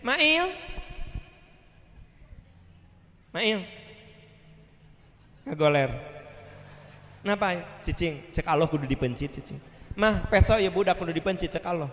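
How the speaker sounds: mock bossy father calling his son; hammed-up offhand domestic bossiness; brusque father. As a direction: order the household about curtly. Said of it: "Nabi Ibrahim tidak gini".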